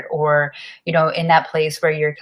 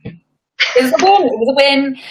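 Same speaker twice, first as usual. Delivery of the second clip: cheering win; 9 semitones higher, 4 dB louder than usual. Real, not acted. Cohost cheering her friend's success, loud and high. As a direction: celebrate it like a victory.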